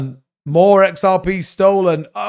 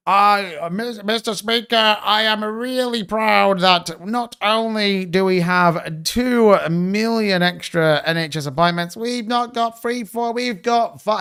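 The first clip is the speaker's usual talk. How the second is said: slow, higher mock politician drone, stretching each word; Keir Starmer impression; 4 semitones higher, much slower than usual. Streamer mocking Keir Starmer at the dispatch box, slow and smug. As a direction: lay it on like a smug PM boasting to the House. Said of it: "you got Keir Starmer there going".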